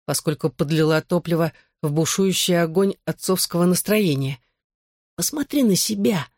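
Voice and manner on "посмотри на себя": sneering. A narrator's reading. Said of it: "язвительно сощурился отец".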